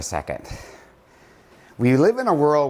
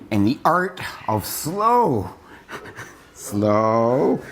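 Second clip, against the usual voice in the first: long stretched vowel on slow; drawn-out slow; much slower than usual. Shown as a demonstration. Coach naming the method mid-exercise, drawn-out slow. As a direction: savour and stretch the key word.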